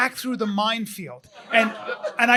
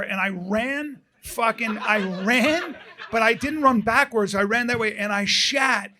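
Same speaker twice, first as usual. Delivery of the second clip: normal talking voice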